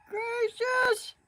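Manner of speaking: high laughing exclamation. Real, not acted.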